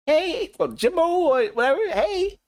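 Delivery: put-on high, sweet greeting; sweet female voice. Friend's wife calling one of the homies, imitated sweet high voice. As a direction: imitate her sugary high-pitched hello. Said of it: "her voice was kinda sweet".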